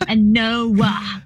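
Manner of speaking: "Noah" said as "no" stretched into two syllables, NO-ah; teen daughter turning "no" into "Noah"; extra syllable. Retold or imitated. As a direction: drag "Noah" out with an added second syllable. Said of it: "no was Noah".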